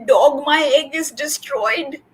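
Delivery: high, distressed storybook cry; lightly acted wail of dismay. A narrator's reading. Cat tells sleeping Dog about the egg, wailing. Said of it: "Cat wails".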